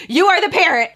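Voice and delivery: loud, high mock-indignant protest; retold protest. Daughter tells mom to check the faucet, loud protest.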